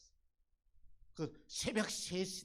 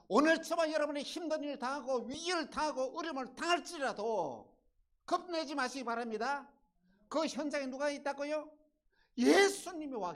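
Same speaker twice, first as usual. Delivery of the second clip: high, loud, rousing exhortation; impassioned pulpit delivery; full-force preaching; 14 semitones higher, 14 dB louder than usual. Real, not acted. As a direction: proclaim it to stir the congregation.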